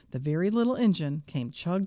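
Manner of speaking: usual speaking voice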